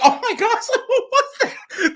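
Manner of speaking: high, laughing mock shock; high laughing what was that. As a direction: gasp and laugh like the cast backstage. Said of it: "And everybody's just like".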